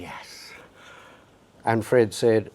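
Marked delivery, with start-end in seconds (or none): 0.0-0.5 whispered inner cheer, much softer; silent inner yes. Retold or imitated. Young photographer hearing the job offer, whispered. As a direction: breathe the yes, no voice. And I'm thinking.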